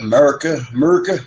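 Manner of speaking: high, loud America chant; a hint of a rallying cry; lightly hammed-up patriotic yell; mock patriotic call. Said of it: "Somebody say America".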